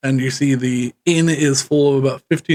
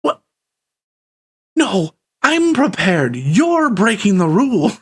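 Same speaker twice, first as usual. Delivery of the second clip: indignant npc; 6 semitones higher than usual. Acted. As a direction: accuse them of cheating. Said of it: "He goes".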